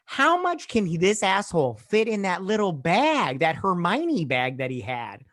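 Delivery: high, loud disbelief, easing off at the end; incredulous rant. Acted. Host watches the thief fill his bag, incredulous rant. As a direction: exclaim it in exasperated amazement.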